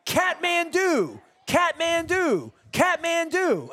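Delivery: high, drawn-out mock scream; screaming wife. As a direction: imitate an overexcited wife screaming out a name. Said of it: "my wife starts screaming".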